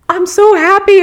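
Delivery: very high, loud happy gush; gushing happiness. Retold or imitated. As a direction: burst with joy at the driver. Gushing to the kind driver.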